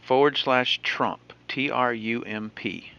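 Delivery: slow, letter by letter spelling; dictating. Real, not acted. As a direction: spell it out so it's written down.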